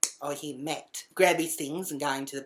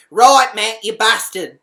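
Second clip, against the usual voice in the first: loud, higher accusing shout; angry call-out; 6 semitones higher, 16 dB louder, slower than usual. Retold or imitated. Catching the housemate through the window, loud angry call-out. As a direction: shout it at the culprit.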